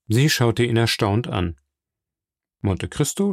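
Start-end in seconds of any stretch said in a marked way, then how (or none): none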